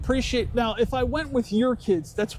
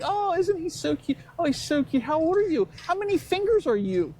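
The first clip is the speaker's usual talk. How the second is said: cooing adult; 8 semitones higher than usual. Retold or imitated. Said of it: "everyone plays it off like".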